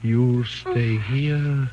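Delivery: very slow, deliberate; coaxing, eerie calm; hypnotic persuasion. Acted. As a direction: lull her into staying word by word. Husband hypnotizing wife to stay.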